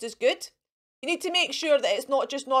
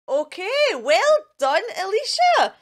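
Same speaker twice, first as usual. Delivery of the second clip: higher and louder, cheering the winner; excited congratulations; 8 semitones higher, 5 dB louder than usual. Real, not acted. Teacher congratulating Alicia on winning the draw, excited. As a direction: celebrate her like she won big.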